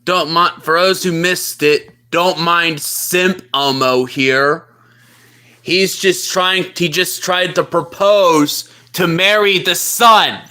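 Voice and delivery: low mocking buddy voice; deep-voiced plushie friend. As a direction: tease him dryly from way down low. Low plushie talks to chat.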